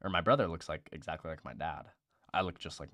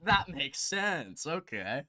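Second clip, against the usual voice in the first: sarcastic approval; 7 semitones higher, 4 dB louder than usual. Retold or imitated. Podcaster mocking the health department pick, sarcastic. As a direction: drag it out like it all makes sense now. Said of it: "you're like".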